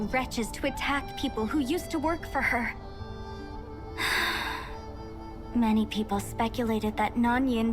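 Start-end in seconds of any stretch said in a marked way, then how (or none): 2.8-5.5 long soft sigh; drawn-out airy sigh. Acted. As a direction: let out a long, quiet breath. Pause in grave account of betrayal.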